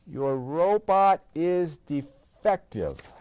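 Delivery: slow, odd announcing voice; slow card voice. A narrator's reading. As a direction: read it out slowly like a machine. Card voice read.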